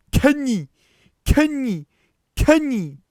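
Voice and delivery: high, piercing repeated shouts; manic yelling. Acted. Guy in the back still yelling, high manic shouts. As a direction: yell each one at full force, pitched high. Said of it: "The guy in the back still yelled".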